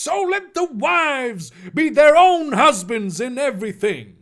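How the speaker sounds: high, booming fire-and-brimstone reading; preacher voice. Pretend. Reader whipping out Ephesians for a congregation, preaching. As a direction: thunder the verse from the pulpit. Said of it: "preacher voice".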